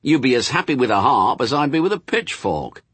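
high, insistent bickering; arguing. Acted. Demon and angel bicker over harps, insistent.

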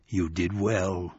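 low, slow, soft otherworldly voice; buffalo man whisper. Acted. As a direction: speak low from the dark. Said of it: "whispered the buffalo man".

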